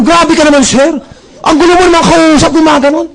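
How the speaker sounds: the clerk snapping back at her boss; retold yelling back. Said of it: "Sinigawan din ako".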